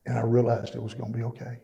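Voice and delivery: ordinary talk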